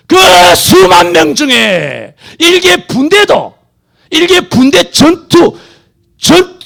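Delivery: high, loud, shouting sermon; fiery preaching. Real, not acted. Preacher scolding megachurches at full force, shouting. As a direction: thunder at the flock, slow and hammering.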